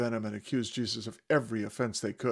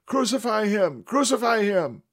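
much higher, loud mob chant; shouting louder; 11 semitones higher, 9 dB louder than usual. Acted. Mob drowning out Pilate's voice. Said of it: "they shouted even louder".

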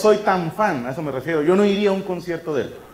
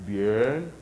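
low, soft, drawn-out and rising; impressed approval; 7 semitones lower, 6 dB quieter than usual. Acted. Listener reacting to ten auditorium shows, impressed. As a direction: savour it slowly, deeper and quieter.

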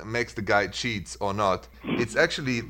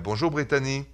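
Brittany said the French way, BREE-tah-NEE, with a French R; French accent; 3 semitones higher than usual. Acted. Host greeting caller Brittany, in a French accent. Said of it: "I love the way that you say my name".

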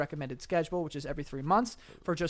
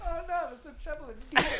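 squeaky high helium echo; 10 semitones higher, 3 dB quieter, faster than usual. Real, not acted. Repeats in helium voice.

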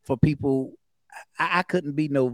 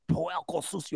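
Spanish accent on puerco sucio; Puerto Rican Spanish; 4 dB quieter than usual. Shown as a demonstration. Showing how his ex-wife said it, in Puerto Rican Spanish. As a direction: roll puerco sucio like a native speaker. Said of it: "sound just like in her culture".

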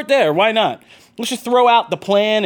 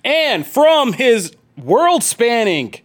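show announcer intro; 4 semitones higher than usual. Acted. Host introducing co-hosts to the listeners, announcer hype. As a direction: stretch out the big titles like an announcer.

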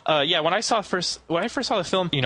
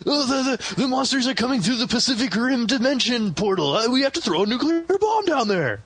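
high, hammed-up alarm; mock panic; 9 semitones higher than usual. Host parodying monster-movie heroes, mock panic. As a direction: raise the pitch like a movie official panicking.

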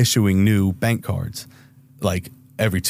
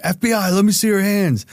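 high barked FBI order; mock police command; 10 semitones higher than usual. Agents ordering him to show his hands, a mock police shout. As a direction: imitate the agents' sudden command.

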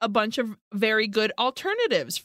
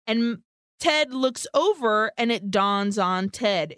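ordinary delivery

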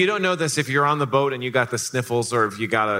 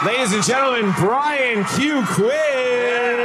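announcer shout; 10 semitones higher, slower than usual. Acted. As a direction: announce the surprise guest big. Announcing the surprise guest.

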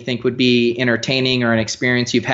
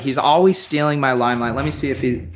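ordinary talk

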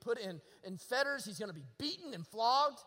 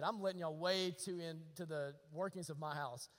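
normal talking voice

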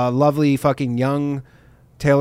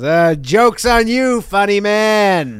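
very slow, higher mock taunt; gloating taunt; 4 semitones higher, much slower than usual. Flight attendant getting back at comedian, gloating taunt. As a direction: draw it out tauntingly, pitched up.

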